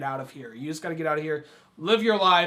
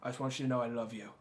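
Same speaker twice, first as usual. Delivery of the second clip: quiet sincere quote; 6 semitones lower, 8 dB quieter than usual. Retold or imitated. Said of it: "He's just like".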